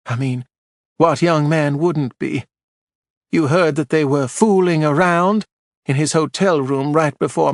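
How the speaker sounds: a prim widow spreading scandal on the stand; Marjorie, gossipy widow. A narrator's reading.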